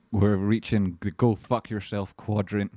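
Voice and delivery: ordinary talk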